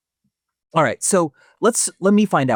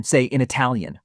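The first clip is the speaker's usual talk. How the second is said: ordinary talk, nothing marked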